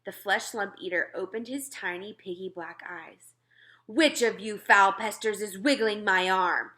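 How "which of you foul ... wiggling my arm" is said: louder, angry bellow of a woken giant. Acted. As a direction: roar it at the pests on your arm. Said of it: "he bellowed".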